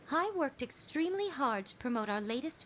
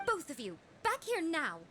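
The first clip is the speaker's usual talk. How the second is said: urgent call; 7 semitones higher than usual. Acted. Yae urgently summons them back.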